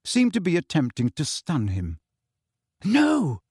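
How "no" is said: high sharp cry; a high cry of alarm. A narrator's reading. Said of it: "cried Hermione".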